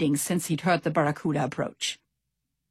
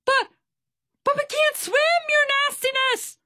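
very high, frightened squeal; screeching with fear. A narrator's reading. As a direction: squeak out the excuse in panic. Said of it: "Swordfish screeched with fear".

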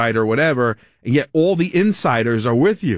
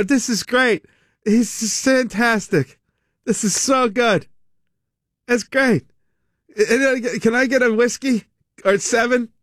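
a touch higher, fake-enthusiastic candidate; mock forced cheer; 6 semitones higher, slower than usual. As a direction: sound delighted through a plastered smile. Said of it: "They go".

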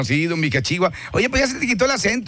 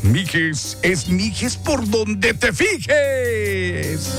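slogan with very long stretched endings; drawn-out slogan cry; much slower than usual. Real, not acted. DJ hyping a town slogan, drawn-out cry. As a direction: stretch it out like a radio hype man.

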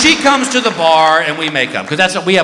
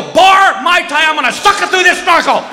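much higher, loud frantic yell; panicked shout; 11 semitones higher, 4 dB louder than usual. Retold or imitated. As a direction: yell it over your shoulder running. Fleeing to the bar.

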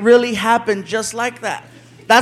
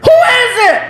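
high, mock-irritated holler; high shouted challenge; 12 semitones higher, 4 dB louder than usual. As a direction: imitate a man calling through a door. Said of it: "We hear".

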